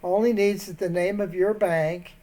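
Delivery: ordinary delivery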